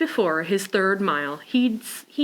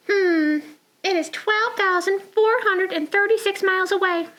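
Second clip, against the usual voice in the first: high little penguin voice; 11 semitones higher than usual. Acted. Penguin character speaks.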